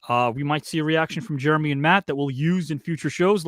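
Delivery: ordinary delivery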